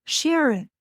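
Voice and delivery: calling a name high and eager, like a woman on the phone; woman calling Sharon through the phone; female voice calling. Retold or imitated. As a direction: call out the name like an excited stranger. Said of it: "and she's going".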